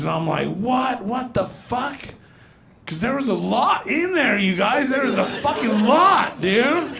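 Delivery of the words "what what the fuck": high, incredulous reaction; high-pitched disbelief, trailing off slowly; retold shock. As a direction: act out being stunned by the news. Shocked reply to doctor, retold.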